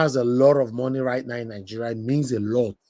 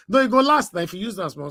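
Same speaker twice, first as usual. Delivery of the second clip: laughing; 8 semitones higher, 4 dB louder, faster than usual. Real, not acted. Joking about soap prices.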